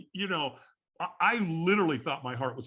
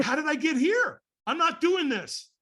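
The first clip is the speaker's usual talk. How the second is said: high, loud protest; acted incredulous refusal, drawn out at the end; alarmed disbelief; 11 semitones higher, 5 dB louder than usual. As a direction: balk in pitched-up panic. Acted shock at where he is.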